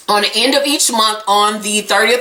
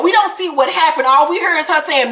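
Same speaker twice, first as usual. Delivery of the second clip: usual speaking voice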